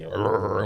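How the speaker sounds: mimicked "rrr" of a growling dog; imitated dog growl. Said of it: "my dog growling at nothing".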